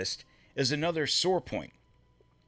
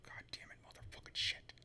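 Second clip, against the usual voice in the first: whispered cursing; 16 dB quieter than usual. Real, not acted. Frustrated cursing at slow upload.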